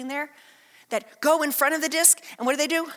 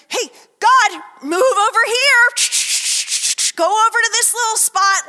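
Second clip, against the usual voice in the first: much higher, loud yelled directions; hammed-up shouting at God; mock bossy calling; 9 semitones higher, 9 dB louder than usual. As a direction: call out to God like a curling skip. Directing God like curling stones.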